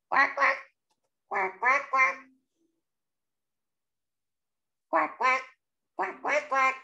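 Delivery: short, quick duck quacks; duck quacking. A narrator's reading. Excited ducky poking its head out, short quacks. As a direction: imitate a little duck quacking. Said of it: "He poked his head out like this".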